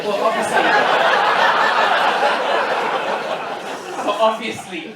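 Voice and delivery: cracking up at his own joke; laughing. Real, not acted. Telling the audience about his daughter, laughing.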